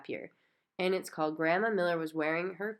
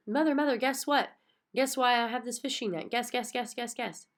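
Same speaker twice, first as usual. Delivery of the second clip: excited; 6 semitones higher than usual. A narrator's reading. Child bursting into the kitchen to tell mother, excited. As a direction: bounce with excitement.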